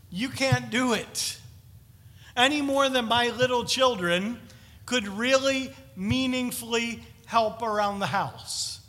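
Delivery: ordinary delivery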